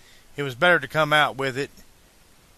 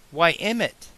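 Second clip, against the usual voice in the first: higher, sweetly voiced woman's greeting; 6 semitones higher than usual. A narrator's reading. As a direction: greet him sweetly in a raised pitch. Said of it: "Clara began sweetly".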